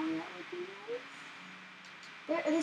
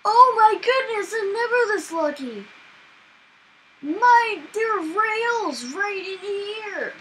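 high, loud, drawn-out amazement; thrilled surprise; 8 semitones higher, 9 dB louder, slower than usual. Real, not acted. Player amazed to find rails in here, thrilled. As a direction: exclaim over an unbelievable lucky find.

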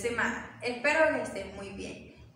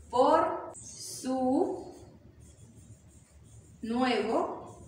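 slow, with long gaps after each word; word-by-word dictation; much slower than usual. Shown as a demonstration. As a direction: dictate slowly while writing it out. Continues writing out the sentence.

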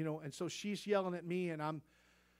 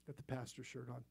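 murmured echo; 6 semitones lower, 13 dB quieter than usual. Retold or imitated. Echo of the thought.

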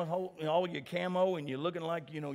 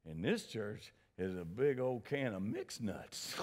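low, slow Rochester New York impression; Leonard Sweet; 6 semitones lower, 7 dB quieter, slower than usual. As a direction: quote the mentor in a deep, unhurried Rochester New York voice. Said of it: "talk in that big Rochester, New York voice".

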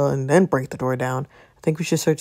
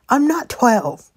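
indignant; 7 semitones higher, 6 dB louder, slower than usual. Acted. Told not to get lost.

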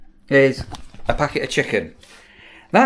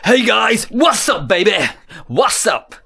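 mock YouTuber greeting; 5 semitones higher than usual. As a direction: wave your wrist and yell the greeting. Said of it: "you have to flick your wrist".

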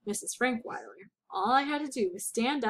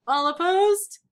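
louder, higher official call to the room; calling the vote; 7 semitones higher, 8 dB louder, slower than usual. A narrator's reading. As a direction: ring out the question like the chair of a meeting. Board member calls a vote.

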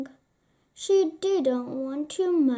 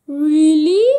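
surprised king; 12 dB louder than usual. A narrator's reading. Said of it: "said the king".

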